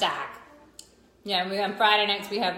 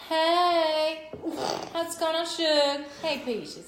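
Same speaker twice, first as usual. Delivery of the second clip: higher-pitched, hammed-up Southern greeting; Southern accent; 8 semitones higher, 4 dB louder, much slower than usual. Friend imitating Southerners for her co-host, high Southern accent. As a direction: pitch up into sugary Southern endearments. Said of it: "your imitation of Southern accent".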